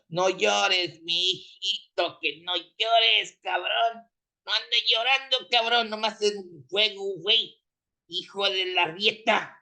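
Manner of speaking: high, drawn-out Mexican Spanish homie voice teasing a crybaby; homie's Mexican slang voice. Retold or imitated. Driver mocks upset Chiefs fans in a Mexican Spanish homie voice. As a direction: needle him in a Mexican Spanish homie voice, don't cry, it's just a game. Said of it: "know a homie that used to talk like that".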